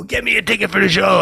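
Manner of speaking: high, loud insistent yell; full-voiced heckler's holler. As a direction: shout the request across the room. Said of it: "I yell that out".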